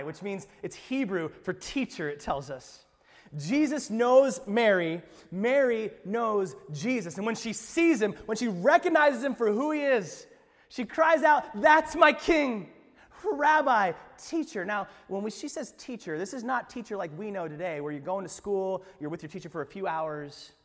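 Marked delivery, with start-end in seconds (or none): 3.4-14.7 much louder, higher, slow and fervent; full-force preaching. Acted. Pastor proclaiming Mary's recognition, loud and fervent. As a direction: proclaim it to the rafters.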